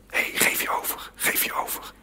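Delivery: hushed, whispered urging; very soft voiceless coaxing. Acted. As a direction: whisper it like a private nudge to let go.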